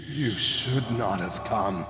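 slow, soft, hollow dead man's groan; slow soft corpse groan. Acted. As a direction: warn him from beyond the grave. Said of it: "Lucas groaned".

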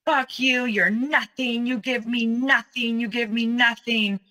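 high, sustained mock scream, held back in volume; retold scream. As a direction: imitate a relentless screaming rant. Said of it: "screaming like I've never heard a human being scream before".

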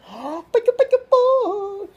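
very high, long playful gasp; dad delighted at his son, high gasp. Real, not acted.

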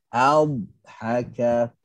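adhaka with the dh held long on the tongue edge; held dad. Shown as a demonstration. Teacher demonstrates istitalah in adhaka. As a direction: linger on the dh of adhaka without stopping. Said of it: "dilamakan pada makhraj".

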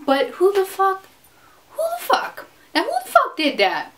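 high incredulous who the fuck; incredulous rant. Real, not acted. Vlogger ranting at men's arrogance, high incredulous demand. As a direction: demand it, pitch jumping up.

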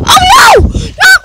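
loud, high play-panic cry; mock alarm. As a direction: exclaim in pretend horror. Reacts to figure in danger.

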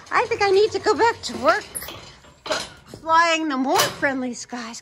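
high, stretched-out grumble to passengers; play-acted exasperation, pitched high; mock complaint. Mock complaint about the pretend passengers.

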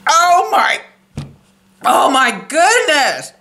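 talking with food in mouth; disgusted, hand over mouth; mouth full. Real, not acted. Seen 2: Food goes in at -5; visible in mouth, hand over mouth.

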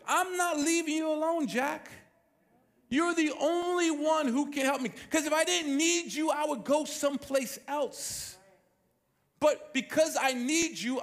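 high, insistent plea of a widow demanding justice; pleading widow. Acted. Widow pleading with the unjust judge, high and insistent. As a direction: press the judge hard, never letting up.

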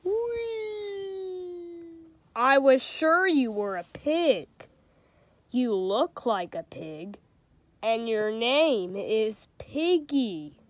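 storybook reading with light character voices; reading to children. A narrator's reading. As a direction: read it like a bedtime picture book. Reads a picture book aloud.

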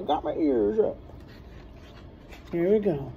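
usual speaking voice

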